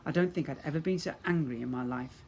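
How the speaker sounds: plain reading voice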